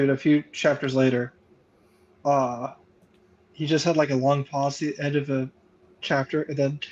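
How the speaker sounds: usual speaking voice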